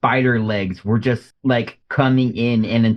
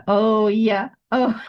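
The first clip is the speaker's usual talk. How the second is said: mock sex sounds; 8 semitones higher, much slower than usual. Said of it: "making sex sounds".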